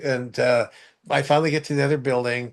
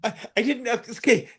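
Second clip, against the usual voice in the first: high laughing protest, getting higher; laughing embarrassed; 8 semitones higher than usual. Retold or imitated. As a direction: giggle it off, flustered.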